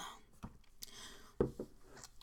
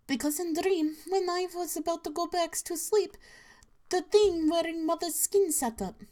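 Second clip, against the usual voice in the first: a hint of a scared daughter; 7 semitones higher than usual. Daughter voiced in story.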